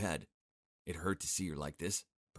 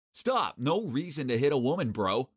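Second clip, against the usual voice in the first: loud, urgent high shout; 6 semitones higher, 7 dB louder than usual. Acted. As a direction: yell to stop him. Stops a man hitting her.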